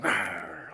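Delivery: low rolled growl; dog growl. Retold or imitated. Preacher imitates the big dog biting the doorknob, growling. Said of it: "he starts going".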